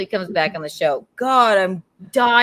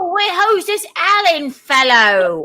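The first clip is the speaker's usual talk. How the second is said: hammed-up Cockney local; Cockney accent; 8 semitones higher, 3 dB louder than usual. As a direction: put on an exaggerated Cockney, pitched high. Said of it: "out of all the British accents".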